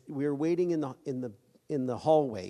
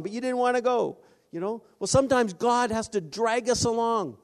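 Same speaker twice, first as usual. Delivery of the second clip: high, loud sermon delivery; preaching at full force; 8 semitones higher, 4 dB louder than usual. Acted. Preacher telling the church about reluctance, full-force preaching. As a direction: drive it home to the congregation, raised and ringing.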